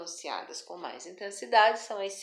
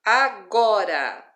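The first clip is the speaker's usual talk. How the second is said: "Agora" loud and slow, middle GO emphasized; a-GO-ra, punching the middle of "Agora"; teacher stretching "Agora" around a strong GO; stress on GO; 10 dB louder, much slower than usual. Shown as a demonstration. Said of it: "go é sílaba tônica".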